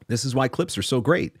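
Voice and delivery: normal talking voice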